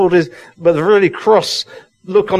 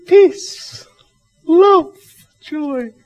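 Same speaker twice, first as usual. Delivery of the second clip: mocking high sugary voice, far above his own; sing-song parody of saccharine piety; syrupy mock-sweet blessing; sickly sweet; 12 semitones higher, much slower than usual. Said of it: "the sickly, sweet".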